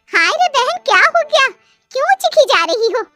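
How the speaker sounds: high, shrill-free neighbour auntie voice; neighbour woman. A narrator's reading. (पड़ोसन की ऊँची आवाज़)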